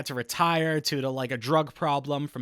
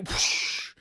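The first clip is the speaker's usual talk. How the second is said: 1 fan whoosh. Retold or imitated. Fan snapping open.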